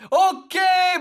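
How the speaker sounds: real excited okay; excited shout. Real, not acted. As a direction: cheer it out. Reacting to the game result.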